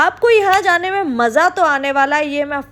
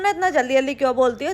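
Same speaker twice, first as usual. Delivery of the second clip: rapid fire; faster than usual. Real, not acted. Rhetorical question about her fast speech.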